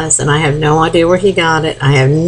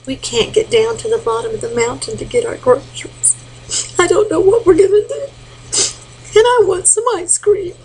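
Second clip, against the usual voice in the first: put-on high, whiny little boy pleading; a small boy near tears wanting ice cream; 13 semitones higher than usual. Grandma voices the crying boy, high and whiny. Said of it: "And he said".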